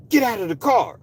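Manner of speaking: high, hollered police order; screaming officer. Retold or imitated. Officer ordering a woman from her car, screaming. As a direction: bellow it like an officer losing control. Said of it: "You screaming and you hollering".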